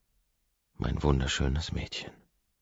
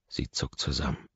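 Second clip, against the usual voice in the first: ordinary delivery, nothing marked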